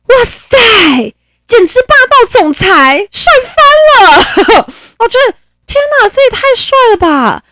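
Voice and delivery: high, loud squealing swoon; swooning excitement. Real, not acted. Host swooning over the king's bold decree, squealing excitement. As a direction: squeal like a fangirl over a domineering CEO.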